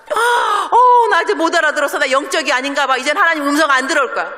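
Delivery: mock despair. A believer who misheard God whines that she is giving up. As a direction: ham up a believer's sulky despair.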